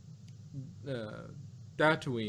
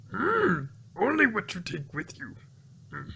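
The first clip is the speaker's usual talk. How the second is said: Yoda voice; 7 semitones higher than usual. Acted. Yoda voice again.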